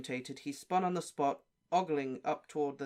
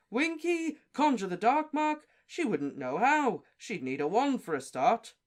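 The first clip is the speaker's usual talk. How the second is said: high, loud, then slowing; incredulous scoff; 8 semitones higher, 7 dB louder than usual. Acted. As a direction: scoff at the very idea. Bagman scoffing at accusation.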